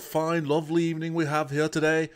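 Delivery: hammed-up showman brogue drifting from Irish; random put-on accent. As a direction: streamer greets chat in a mock Irish accent. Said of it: "decided to put on an accent right at the top".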